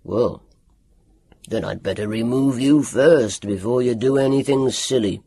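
lightly voiced gruff doctor, low grunt; a low, grumbling doctor's threat; gruff old doctor. A narrator's reading. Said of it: "grunted Doctor Welander".

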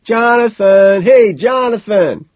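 loud, slow crowd shout. A narrator's reading. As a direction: shout his name with the crowd. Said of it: "crowd starts shouting".

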